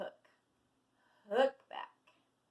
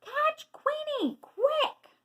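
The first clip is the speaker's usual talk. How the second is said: very high, loud urgent cry; rally everyone in a shrieking duck voice; squawking duck; 12 dB louder, much slower than usual. A narrator's reading. Dottie sending animals after the dog, urgent squawk. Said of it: "Dottie's loud quacking".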